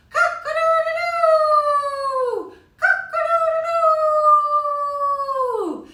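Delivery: high, very slow, loud crowing; rooster crow. Acted. Teacher crows like a rooster for the kids, high and loud. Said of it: "heard a rooster go".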